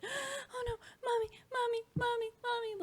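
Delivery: put-on high, wailing little-kid voice; mother mimicking her terrified son, crying high; imitating crying toddler. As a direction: play the scared little boy sobbing for mommy. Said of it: "Jonathan screaming, crying".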